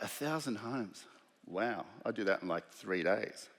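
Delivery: soft, slow disbelief; hushed, slow, unimpressed awe; incredulous. Retold or imitated. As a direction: say it quietly, as if it's impossibly many. His disbelieving reaction.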